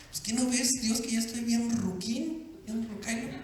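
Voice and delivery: lightly voiced old man, softly; Abraham, amused. Preacher as old Abraham answering God, soft mock amusement. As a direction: imitate a quiet, doubting chuckler. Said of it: "Abraham se rió".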